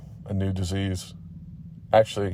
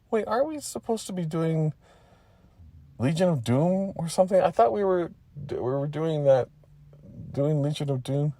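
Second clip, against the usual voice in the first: high-pitched, hesitant character voice; puzzled Letty; 8 semitones higher than usual. Acted. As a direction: ask uncertainly in a high voice.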